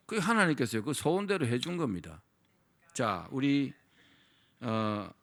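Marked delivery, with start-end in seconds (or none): none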